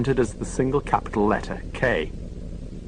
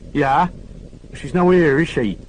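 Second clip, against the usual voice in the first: high, louder Oxfordshire lass, suspicious; Oxfordshire woman; 6 semitones higher, 5 dB louder than usual. Acted. Oxfordshire woman brushing off Lewis on the phone. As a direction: Oxfordshire accent, blunt and wary. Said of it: "her curly Oxfordshire accent".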